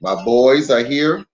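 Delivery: ordinary talk, nothing marked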